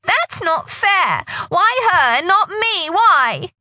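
high, slow, loud wail. Acted. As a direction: wail it out in despair. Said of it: "Rachel wailed".

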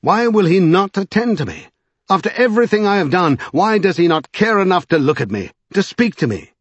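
high, loud, wounded outcry; rage and self-pity. A narrator's reading. Strange railing at being ignored, raging and self-pitying. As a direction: demand it like a spurned pupil. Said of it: "With a cry of mingled rage and self-pity".

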